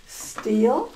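ordinary talk

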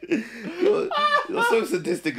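squeaky laugh-cry; laughing too hard to talk. Real, not acted. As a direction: choke the words out through laughter. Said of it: "laughing fit".

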